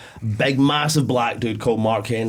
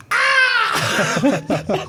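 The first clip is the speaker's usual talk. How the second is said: mock scream. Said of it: "you hear the kid going".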